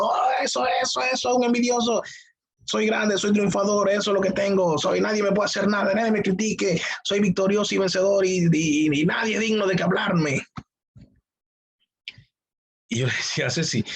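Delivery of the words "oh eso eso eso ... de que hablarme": high, hyped-up mock crowd chant; imitating shouting worshippers. Pastor mimicking people whipped up by the preacher, mock shouting. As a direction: parody a congregation cheering itself on. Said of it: "la gente está gritando y levantando la mano diciendo".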